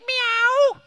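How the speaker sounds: high, loud comic meow; mock cat meow. Magician gets the audience to copy him, mock cat meow. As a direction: squeal it like a silly cat.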